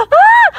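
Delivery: high gasp of wonder; amazed gasp. Acted.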